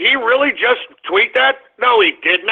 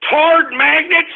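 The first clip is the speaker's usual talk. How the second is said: slow, high-pitched outraged question; incredulous yell; 7 semitones higher, slower than usual. Real, not acted. Host outraged at the nickname, incredulous yelling. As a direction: yell it slowly in disbelief.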